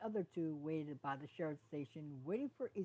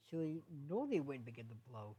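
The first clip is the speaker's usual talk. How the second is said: ordinary delivery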